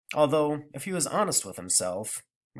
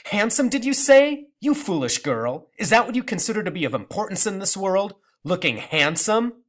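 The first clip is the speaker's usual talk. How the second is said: contemptuous; 7 semitones higher, 6 dB louder than usual. Acted. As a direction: scold her like a fool. Grandmother incredulous scorn.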